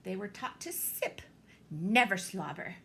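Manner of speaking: ordinary delivery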